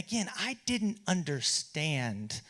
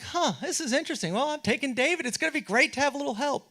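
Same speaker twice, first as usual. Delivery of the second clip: pitched-up, innocent-sounding past self; a lightly mocking, higher naive voice; naive young self; 11 semitones higher than usual. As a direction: play your clueless younger self. His own naive past self.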